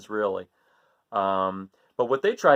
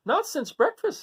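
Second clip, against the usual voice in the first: Mother Goat's voice; 12 semitones higher than usual. Acted. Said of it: "said Mother Goat".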